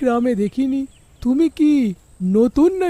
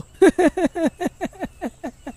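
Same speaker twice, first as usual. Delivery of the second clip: long, high laugh tailing downward; acted laugh; 5 semitones higher than usual. Husband to his annoyed wife, acted laugh. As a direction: laugh it out in a falling run.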